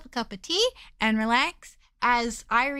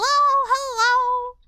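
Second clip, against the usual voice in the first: cute anime streamer; 10 semitones higher, 6 dB louder than usual. Acted. Greeting raiders.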